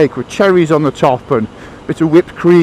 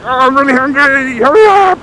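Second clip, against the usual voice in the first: high, loud mock whine; hammed-up squeal of impatience; impatient hunger; 11 semitones higher, 5 dB louder than usual. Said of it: "his anticipation levels are massive".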